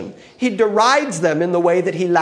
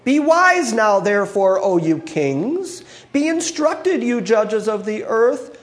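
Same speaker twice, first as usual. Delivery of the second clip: raised, slower solemn Bible reading; scripture reading; 4 semitones higher than usual. Acted. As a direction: proclaim the psalm to the kings. Reads the psalm aloud.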